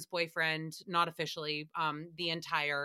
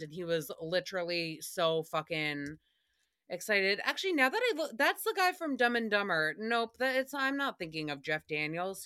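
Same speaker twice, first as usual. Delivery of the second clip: ordinary delivery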